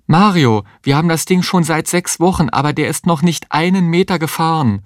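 high-pitched, frantic objection; woman in labour arguing in alarm; desperate protest. A narrator's reading.

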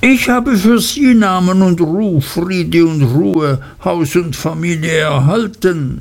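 hurried, cringing, turning the words back; hasty, dismayed Uriah. Acted. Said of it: "mit einer hastigen, bestürzten Miene".

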